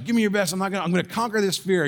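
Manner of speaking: put-on puffed-up boy yelling at the sky; defiant kid. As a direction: rush the brave words out. Kid defies storm again.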